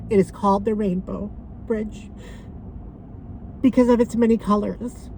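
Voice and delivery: heartfelt memorial reading; poem reading. Acted. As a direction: read the poem gently to the viewers. Reads pet memorial poem aloud.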